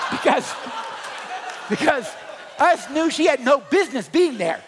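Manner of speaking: rising to a loud, high shout. Real, not acted. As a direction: belt it out to the crowd. Preacher building to a shout.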